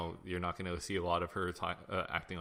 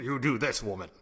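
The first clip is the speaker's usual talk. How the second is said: a touch of a louder, commanding husband; mock bossy Reed; 3 semitones higher, 6 dB louder than usual. Reed ordering Sue around, lightly mocked commanding voice. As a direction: bark it lightly like a patronizing leader. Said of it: "And he says".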